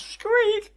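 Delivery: very high, falling squeak; high cartoon mouse squeak.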